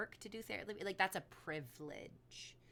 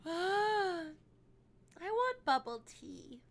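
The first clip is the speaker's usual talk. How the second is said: whiny craving; 9 semitones higher, 6 dB louder, much slower than usual. Real, not acted. Sudden craving, whined aloud.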